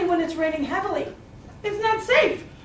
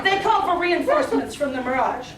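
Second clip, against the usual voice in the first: ordinary delivery, nothing marked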